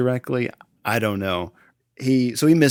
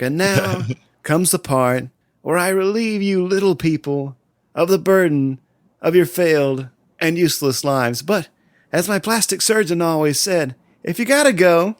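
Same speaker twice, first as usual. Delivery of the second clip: a hint of the villain's gleeful menace, a little higher; lightly voiced Joker; 6 semitones higher, 4 dB louder than usual. Joker to the gassed crowd, a light gleeful menace. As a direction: grin through the threat lightly.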